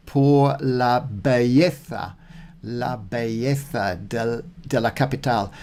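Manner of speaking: "Por la belleza" spelled out slowly, each syllable deliberate; slow deliberate practice reading; slow careful Spanish. Shown as a demonstration. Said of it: "I couldn't understand what that word was".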